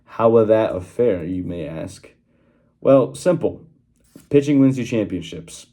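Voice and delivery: usual speaking voice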